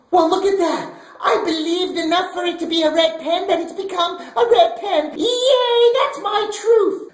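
high, gasping, overjoyed squeal; mock delight. Pastor mocking to kids a believer who wills a pen red, mock delight. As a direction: fake amazement at a pen turning red.

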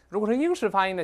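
ordinary talk, nothing marked

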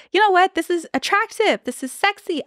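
light up as you tell him; wife reacting to her husband putting his foot down, thrilled; delighted.

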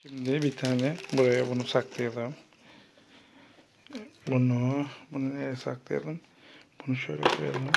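low, very soft self-talk; secretive murmur. Real, not acted. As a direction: murmur to yourself while hiding things. Secretly hiding items from child.

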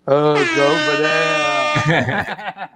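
slow, lazy half-asleep answer; slow drowsy mumble from the couch; sleepy drawl. Retold or imitated. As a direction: mimic a groggy guy barely waking. Guy woken on couch, slow reply.

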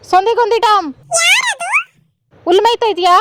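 high, loud call across the yard; sing-song call to a pet; calling out to the dog. Real, not acted. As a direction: shout for the dog to come.

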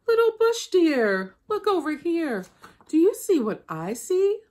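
high coaxing trickster voice; sly trickster Anansi. Acted. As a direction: lure her in like a sly spider. Anansi springs his trick.

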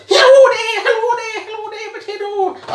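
put-on very high, loud squeaky Irish accent, laughing; squeaky mock Irish. Mocking the Irish in a high Irish accent. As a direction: send up the Irish in a shrill silly voice. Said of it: "And then you've got the".

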